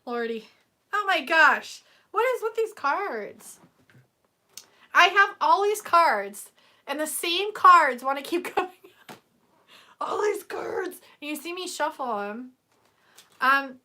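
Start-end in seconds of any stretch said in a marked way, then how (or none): 0.9-11.0 excited. Real, not acted. Excited by repeating cards.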